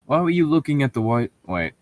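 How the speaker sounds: ordinary talk, nothing marked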